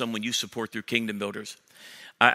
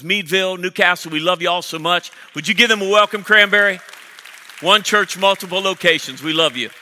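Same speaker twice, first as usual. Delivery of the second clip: calling out to campuses; 8 semitones higher, 10 dB louder than usual. Real, not acted. Pastor welcomes campuses, congregation cheering.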